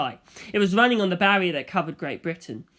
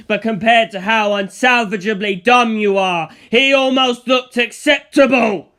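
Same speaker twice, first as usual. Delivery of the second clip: raging villain; 6 dB louder than usual. Acted. Dio cursing Antonio as worthless, raging. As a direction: rage and belittle him with contempt.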